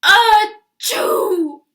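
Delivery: huge high sneeze; loud sneeze. A narrator's reading. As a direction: blast out an enormous sneeze. Wolf's great sneeze.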